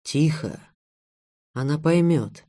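usual speaking voice

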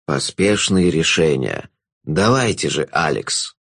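low, slow, drawn-out jester voice; a knowing villain savouring the moment; low, slow jester passing judgement on the girl; sly jester. A narrator's reading.

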